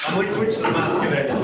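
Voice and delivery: ordinary delivery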